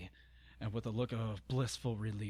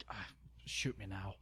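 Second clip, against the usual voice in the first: despairing whisper; 3 semitones lower, 8 dB quieter than usual. Real, not acted. Reader despairs at the text.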